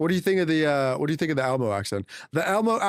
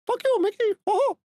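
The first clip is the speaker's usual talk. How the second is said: very high falsetto cartoon mouse; squeaky mouse with a chuckle; Mickey Mouse. Streamer answering a request, squeaky mouse voice. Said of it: "Mickey Mouse".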